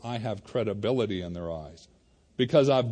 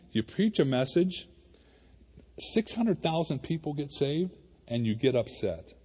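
normal talking voice

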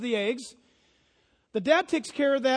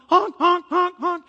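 loud, higher honking; goose honks; 6 semitones higher, 10 dB louder, slower than usual. Retold or imitated. Pastor imitating flying geese, loud honking. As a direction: honk like geese flying along.

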